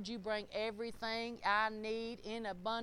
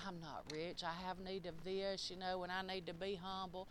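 mock humble; 5 semitones lower, 11 dB quieter than usual. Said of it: "we like to think of ourselves".

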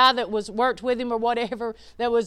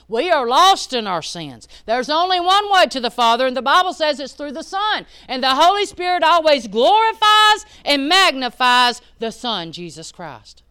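higher and louder, preaching builds; building preaching; 5 semitones higher, 8 dB louder than usual. Acted. Pastor preaching salvation through the Son, building. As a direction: drive the point home to the congregation.

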